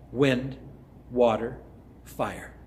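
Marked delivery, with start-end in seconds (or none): none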